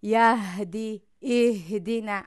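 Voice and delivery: یهدی loud and slow, ه pushed from near the lungs; loud drill. Shown as a demonstration. Teacher drilling the breath letter loudly. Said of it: "راه نفس".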